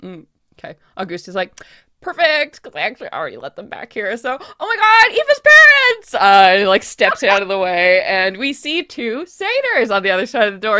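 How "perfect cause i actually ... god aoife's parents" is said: fast, then a high loud cry; excited announcement. Acted. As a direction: build to a grand reveal. Auguste announces parents.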